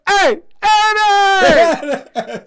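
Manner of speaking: high, loud, long-drawn holler; calling out. Acted. Retelling the movie scene, loud drawn-out mock holler. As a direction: stretch the name into a comic yell. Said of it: "And he said".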